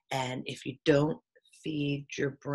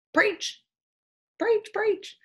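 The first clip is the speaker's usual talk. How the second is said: mocking sing-song preacher, pitched way up; 4 dB louder, much slower than usual. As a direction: parody a preachy voice, high and loud.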